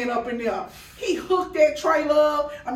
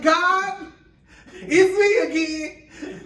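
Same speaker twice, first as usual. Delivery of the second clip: hammed-up tearful plea to God, pitched up and louder; mock crying prayer; 6 semitones higher, 5 dB louder, slower than usual. Chef retelling crying to God at the trailer, mock-tearful. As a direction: imitate herself boo-hoo praying by the trailer. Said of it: "I'm crying and asking God".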